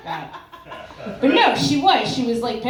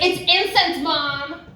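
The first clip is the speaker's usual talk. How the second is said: retold protest; 6 semitones higher, slower than usual. Teen retells lying to her mother.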